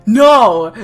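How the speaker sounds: loud repeated no; horrified protest. Real, not acted. Player yelling at the game advice, loud repeated no. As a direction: shout it down.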